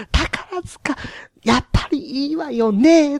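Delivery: very high mock-ladylike voice; chatting housewife. Lady chatting about Takarazuka on the train, high mock-ladylike voice. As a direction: imitate a theatre-going lady, high and contained. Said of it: "今ぐらいのトーンでできるじゃないですか".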